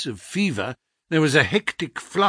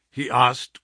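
ordinary delivery

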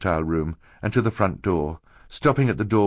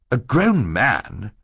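slow stern opening; 3 semitones higher, much slower than usual. A narrator's reading. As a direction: lecture firmly and slowly. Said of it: "Mister Poe said sternly".

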